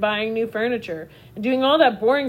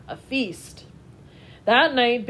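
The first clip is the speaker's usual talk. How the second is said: usual speaking voice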